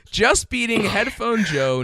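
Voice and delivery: ordinary talk, nothing marked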